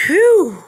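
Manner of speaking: loud, high whew of relief; big storybook sigh of relief; relieved whew. A narrator's reading. Machine finally stops in the story.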